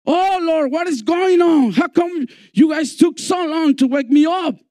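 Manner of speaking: high, exasperated complaint; flustered Jesus. Retold or imitated. Jesus waking and scolding his disciples, high and flustered. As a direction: act startled and put out at being woken. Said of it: "just imagine Jesus getting up and saying".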